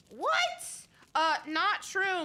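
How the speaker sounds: sudden high yelp of disbelief; shocked what. Real, not acted. Gamer shocked by a creepy note, high yelp. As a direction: squeak it out in shock.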